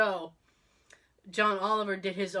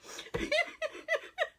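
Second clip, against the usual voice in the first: high helpless laugh; a real high-pitched giggle; burst of laughter; 4 dB quieter than usual. Real, not acted. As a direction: crack up at the punchline. Laughing at her own story.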